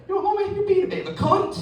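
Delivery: put-on high, cheeky Scottish kid voice; Scottish girl. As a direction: insult him like a tough little girl.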